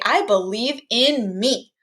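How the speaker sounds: slow, every word weighed; deliberate, emphatic self-belief; enunciated affirmation. Shown as a demonstration. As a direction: say it like you mean it. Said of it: "pause or more of a enunciation".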